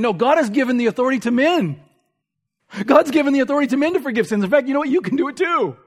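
mock misreading. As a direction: parody the wrong reading, rushing. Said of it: "Jesus isn't here saying".

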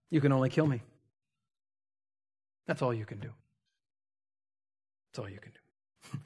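hushed, unmoved resolve; quiet defiance. Retold or imitated. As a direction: repeat it calmly, letting it sink away. Acted defiance, repeated ever quieter.

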